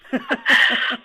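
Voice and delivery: loud, high burst of laughter; real laugh. Real, not acted. Host laughs after asking guests for playlist tunes, loud laugh. Reacting to his playlist question.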